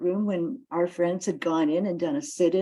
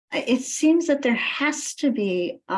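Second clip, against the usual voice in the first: normal talking voice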